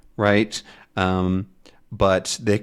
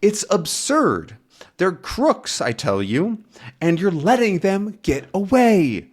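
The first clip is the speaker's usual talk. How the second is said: Tintin protesting; 8 semitones higher than usual. Acted. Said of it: "And now Tintin says".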